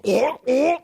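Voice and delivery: high, startled little yelps; high panicked kid yelps. Retold or imitated. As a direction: yelp like a kid caught off guard. Kid with dangling cheese.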